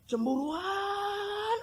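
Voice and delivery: whiny jealous wife. Retold or imitated.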